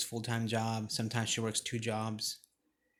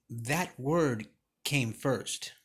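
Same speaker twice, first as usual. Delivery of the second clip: slow emphasis; much slower than usual. Shown as a demonstration. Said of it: "repeating the key line".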